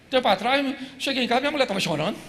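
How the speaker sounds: ordinary delivery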